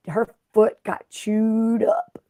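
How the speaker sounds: slow hushed whisper of bad news; sad whisper. Real, not acted. Sad news told to viewers.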